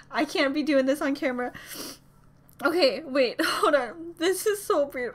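normal talking voice

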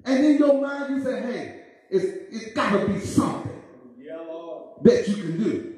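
high shouted sermon climax over music; whooping climax; preaching at full force. Acted. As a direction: ring it out to the church.

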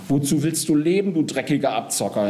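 high-pitched mocking jeer. A narrator's reading. Death aping the whining swindler Geist, mocking. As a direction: throw his whine back at him. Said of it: "Der Tod äfft ihn nach".

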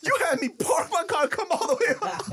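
high, squealed complaint; mock exasperation. Visitor made to come upstairs, squealed mock complaint. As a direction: replay indignant disbelief for laughs.

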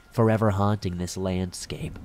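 ordinary delivery

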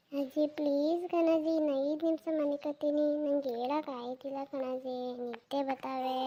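sleepy grandson begging for five minutes; sleepy pleading. Acted. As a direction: whine from under the blanket. Sleepy boy pleads again.

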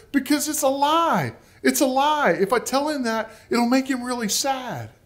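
put-on very high child's protest; imitated whimpering boy. Little boy pleading with his mother, mock crying in a high voice. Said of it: "started to kind of cry and whimper".

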